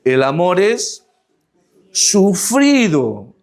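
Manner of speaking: slow deliberate reading, word by word; read slowly. Shown as a demonstration. Preacher reads scripture to the congregation, slow and deliberate. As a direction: stretch out "El amor es sufrido" slowly, with a rise on "es" and a long drawn "sufrido". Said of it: "Vamos a leerlo pausadamente".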